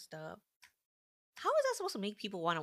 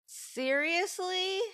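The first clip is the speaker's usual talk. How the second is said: stunned disbelief; 10 semitones higher, 8 dB louder, much slower than usual. Real, not acted. Outraged reaction to viewer news.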